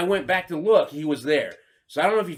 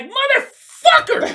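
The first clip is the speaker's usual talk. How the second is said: high, loud, slow startled cry with a laugh; a drawn-out yelp of alarm; alarmed outburst; 9 dB louder, much slower than usual. Retold or imitated. As the groundhog charges him, a high drawn-out alarmed cry.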